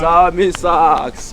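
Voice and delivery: loud, drawn-out name; hailing a friend. Acted. Hailing his visiting friend, drawn-out call. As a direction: stretch the name out loudly in greeting.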